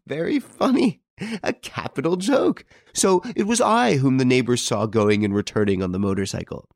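high-pitched scoffing laughter in the words; laughing. A narrator's reading. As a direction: laugh through it, pitched high, then settle as you go on. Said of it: "he began to laugh".

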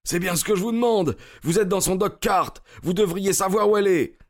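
fast, high-pitched retort; agitated retort. A narrator's reading. Cyclist to Holmes about missing young woman, agitated retort. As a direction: throw the question back, rapid and high.

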